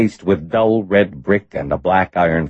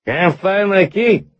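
put-on slow, high, slurring drunk; loud slurred voice; 3 dB louder, slower than usual. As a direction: slur it like a drunk locked out. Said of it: "said in a loud, slurred voice".

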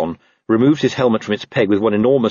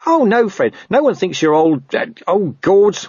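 high, panicky reassurance; squeaky underling putting his foot in it; flustered corporal; 11 semitones higher, 3 dB louder than usual. Acted. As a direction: backtrack and falter in dismay. Nobby flustered, digging himself deeper.

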